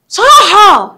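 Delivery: loud, high demonstrative shout. Shown as a demonstration. Teacher showing pupils what raising the voice means, shouting. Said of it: "رفع صوته".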